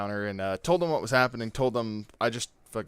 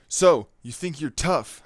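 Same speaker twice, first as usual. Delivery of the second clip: mock tough taunt; 5 semitones higher, 5 dB louder, slower than usual. Bully taunting at the pool.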